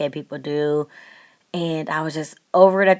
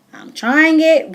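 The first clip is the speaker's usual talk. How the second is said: much higher and louder, eager; excited declaration; 11 semitones higher, 10 dB louder, slower than usual. Real, not acted. Vlogger to viewers, excitedly committing to try it. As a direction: announce the dare with excitement. Deciding to copy UK makeup.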